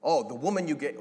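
high, loud blaming retort; pointing the finger, indignant; accusing Adam. Retold or imitated. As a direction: throw the blame back at God. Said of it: "Or is it".

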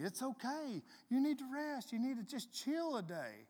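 high-pitched imitation of a reassuring woman; Trina. Imitating her telling him to rest, high and coaxing. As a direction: lift the voice high to coax. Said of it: "And she'll tell me".

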